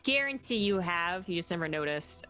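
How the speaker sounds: ordinary talk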